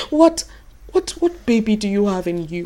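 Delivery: normal talking voice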